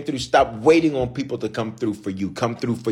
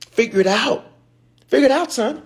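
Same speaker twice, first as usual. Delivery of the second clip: rising preached shout; 12 semitones higher than usual. Acted. Preacher voicing God to his congregation, climbing shout. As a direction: build it up like a fired-up preacher.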